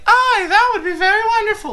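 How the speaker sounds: put-on very high, fast squeaky girl voice; chirpy anime girl chorus; high girly voice. The girls voiced in reply.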